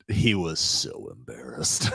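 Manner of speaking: ordinary talk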